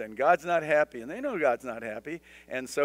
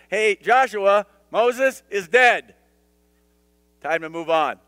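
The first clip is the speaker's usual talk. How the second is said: much louder and higher, slow on "Moses is dead"; blunt divine command; 4 semitones higher, 9 dB louder, slower than usual. Retold or imitated. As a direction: call out firmly to snap him out of grief. God voiced bluntly to Joshua.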